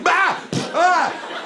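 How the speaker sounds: high yelps to hide a fart; fake scream. Shown as a demonstration. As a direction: cover the noise with a shout. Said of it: "sometimes you either scream".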